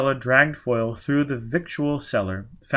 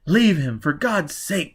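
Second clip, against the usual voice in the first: higher, louder pressing; urgent plea; 6 semitones higher, 4 dB louder, slower than usual. Acted. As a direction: beg her to drop him. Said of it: "Quat urged".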